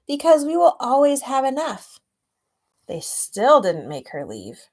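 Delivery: storybook reading; story narration. A narrator's reading. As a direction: read it like a picture book to a class. Reads picture book aloud.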